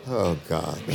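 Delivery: low, weary groan of a fed-up teacher; exasperated Jesus. Retold or imitated. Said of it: "I always have that picture of Jesus going".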